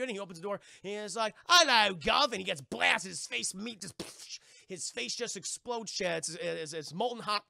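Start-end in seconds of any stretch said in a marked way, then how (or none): 1.5-2.2 loud, bright greeting; cheery butler. Retold or imitated. The butler opening the door to the pirates, a loud, cheery hello.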